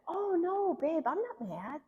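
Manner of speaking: high, placating denial; sweet denial. Acted. Wife answering husband who asks if she's mad, placating.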